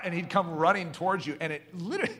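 usual speaking voice

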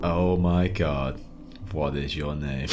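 slow, hushed mock panic; acted dawning dread, low and drawn out; inward panic. Player acting panic at a forgotten name, slow inward panic. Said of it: "that moment of just pure panic".

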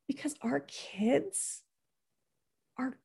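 whisper. Real, not acted. Coach confiding to parents about kids, whispered. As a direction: lean in and whisper it.

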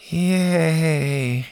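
a dry, mock-happy yay; sarcastic. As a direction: pretend to cheer, not meaning it. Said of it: "Bo said sarcastically".

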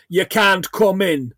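slow, put-on Jamaican accent. Reading out a film character's subtitled line, imitated Jamaican accent. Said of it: "In Jamaican accent".

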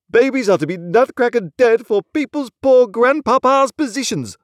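high, loud exasperated lament; hammed-up outraged despair; helpless exclamation. A narrator's reading. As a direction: rail at the heavens in helpless protest. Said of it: "he helplessly exclaimed to the elements".

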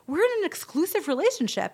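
high-pitched mock protest; retold devastation. Girl learning he dates someone else, mock devastated. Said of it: "this girl's devastated".

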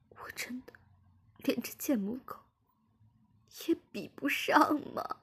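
high-pitched crying plea, breaking into near-whispers; tearful. A narrator's reading. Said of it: "马上哭着说".

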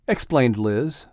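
usual speaking voice

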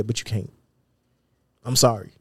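ordinary delivery

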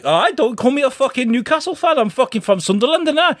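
high-pitched mock North East protest; indignant Sunderland fan. Host imitates Sunderland fans refusing the Newcastle label, indignant. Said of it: "They're like".

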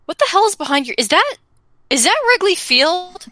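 gasping at the stadium behind the house; shocked disbelief. Retold or imitated.

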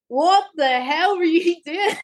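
high, slow, laughing mock scold; half-laughing what-the-hell from the coach; retold coach, laughing through it; coach laughing in disbelief.

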